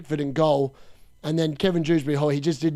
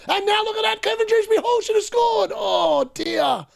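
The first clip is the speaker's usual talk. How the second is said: much higher, near shrieking at the miss; real live disbelief, pitch soaring; excited. Real, not acted. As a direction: yelp it out like a fan watching a sitter go begging. Near miss while watching live.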